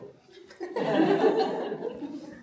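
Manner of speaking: high, loud burst of laughter. Real, not acted. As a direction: let out a big laugh. Laugh burst after joke.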